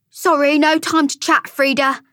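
high character voice, cutting off the chat; brisk brush-off. A narrator's reading. Character brushing past a chatty acquaintance.

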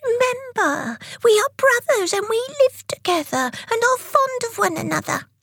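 high, squeaky appeal; pleading. A narrator's reading. Tick reminding Tock they are brothers, pleading.

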